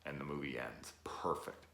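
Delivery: hushed, awed whisper. Real, not acted. Vlogger in awe of the ending, whispering. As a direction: breathe it in reverence.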